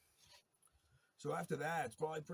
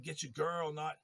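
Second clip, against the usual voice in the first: yelled order; 5 semitones higher, 5 dB louder than usual. Retold or imitated. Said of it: "I said".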